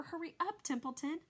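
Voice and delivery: urgent plea. A narrator's reading. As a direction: rush him along. Wilbur begs the rat urgently.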